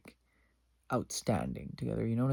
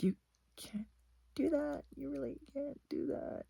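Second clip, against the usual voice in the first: mock protest; 6 dB quieter than usual. High mock scolding quote.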